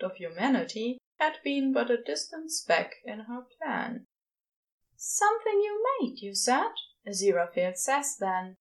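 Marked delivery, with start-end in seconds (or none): 5.0-6.7 higher angel voice; Aziraphale's voice. A narrator's reading. Aziraphale asking Crowley, in a higher voice. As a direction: pitch up for the angel.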